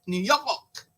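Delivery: "New York" with a New York accent, the r dropped, "Yawk"; a New York accent tried out on "New York". Shown as a demonstration. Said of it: "did I say that accent halfway right".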